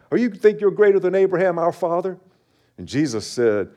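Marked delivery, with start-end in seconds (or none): 0.1-2.2 high, fast incredulous questioning; challenging Pharisees. Acted. Pharisees questioning Jesus about Abraham, incredulous. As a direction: play an affronted accuser, pitched up high.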